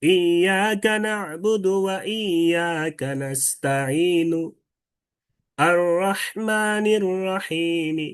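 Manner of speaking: slow, drawn-out Quranic chant with light final vowels on "নাস্তাঈনু" and "রহিমি"; held notes, then a brief faint u in "নাস্তাঈনু" and i in "রহিমি"; rawm recitation. Shown as a demonstration. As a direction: chant each verse slowly, softening the last vowel of "নাস্তাঈনু" and "রহিমি". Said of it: "এটাই হচ্ছে রুম".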